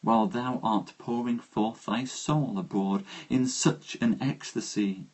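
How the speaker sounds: soft, hushed reading of a romantic ode; poem reading. Acted. Reading Keats to stream viewers, soft and hushed. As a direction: let each line fade and linger like a spoken elegy.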